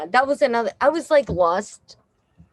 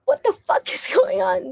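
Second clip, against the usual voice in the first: much higher, startled outburst; shocked disbelief. Retold or imitated. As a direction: squeal it like you can't believe it. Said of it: "I'm like".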